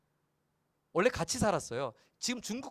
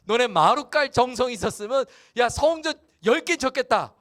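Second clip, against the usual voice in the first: much higher and louder, indignant huff; sulking God; 6 semitones higher, 8 dB louder than usual. Acted. God scolds returned exiles for fancy houses, loud sulky indignation. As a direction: scoff at their new floors. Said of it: "그렇게 삐진 투로 말씀하시는 거예요".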